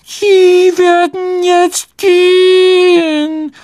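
very high, slow, loud elf voice; squeaky indignant order, every word drawn out; empörter Hauself. A narrator's reading. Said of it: "sagte er empört". (empört, schrill und gedehnt)

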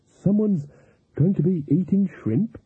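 high, helpful suggestion in character. A narrator's reading. Cook offering her reading of the pot, helpful. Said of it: "she said helpfully".

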